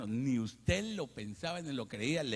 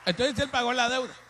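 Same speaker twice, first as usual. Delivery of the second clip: full-force preaching; 10 semitones higher, 9 dB louder than usual. Acted. Preacher proclaiming Christ paid the debt, very loud. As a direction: proclaim it to the rafters.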